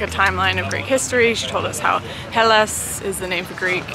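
ordinary talk

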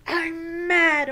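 loud, high mock-mad burst; acted anger. Shown as a demonstration. Teacher naming our feelings for children, acted anger. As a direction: growl up into hammed-up anger. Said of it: "name our feelings".

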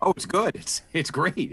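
pleasant surprise. Retold or imitated. Podcaster reliving first hearing the final cut, pleasantly surprised. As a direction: replay your delighted surprise, pitched well up.

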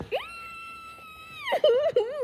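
long, piercingly high squeal. Real, not acted. Player excited by the game, high squeal. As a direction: let out a squeal that ends loud.

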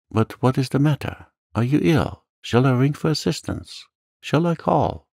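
high, urgent questions; alarmed concern. Acted. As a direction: offer help anxiously, pitch climbing. Dante alarmed at Villefort's distress.

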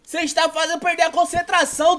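loud, high furious yelling; raging gamer. Real, not acted. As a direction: shout them down, fed up. Yelling at noisy teammates.

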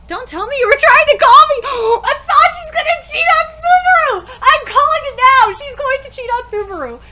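high, loud gasping glee; excited fan predicting the cheating plot twist; gleeful shout. Real, not acted. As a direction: call it like juicy gossip. Predicting a love triangle.

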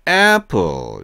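"apple" with its first vowel stretched in two beats; drawn-out two-beat vowel. Shown as a demonstration. Teacher shows learners the two-part vowel, drawn-out "apple". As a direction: draw out the opening ae of "apple". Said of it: "몸이 두 개 있다 생각하시고".

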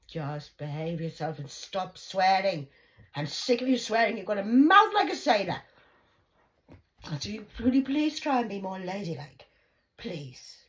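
loud grandma telling off; scolding. Retold or imitated. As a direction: scold her for swearing. Grandma scolding about swearing.

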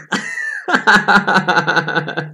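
high laugh; real laugh. Real, not acted. Teacher amused by a student's joke, real laugh.

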